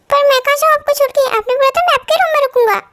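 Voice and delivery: put-on a child protesting he was promised; upset little boy. Little boy pleading with his brother not to be sent away.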